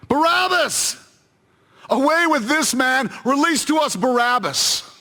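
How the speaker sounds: very high, strained yell of a mob; high-pitched angry clamour; crowd shout. Acted. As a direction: cry out demands over a crowd. Crowd demands Barabbas be released.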